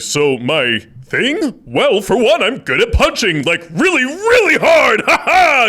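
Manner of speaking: booming, high action-hero read; loud performance. Shown as a demonstration. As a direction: belt it out like a cartoon hero. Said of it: "I was doing all of the volume in person".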